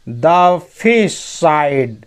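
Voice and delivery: very slow, word-by-word English; slow dictation pace; slow reading. Shown as a demonstration. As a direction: read each word out slowly for learners. Teacher reads story line aloud.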